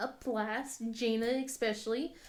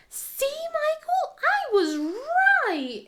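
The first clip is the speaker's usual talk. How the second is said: put-on high, slow, sing-song kid voice gloating; mimicking young daughter; 6 dB louder, slower than usual. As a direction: play the smug little sister. Imitating daughter Jana gloating.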